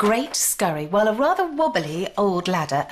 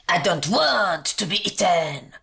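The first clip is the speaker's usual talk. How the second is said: growled. A narrator's reading. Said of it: "growled the jelly".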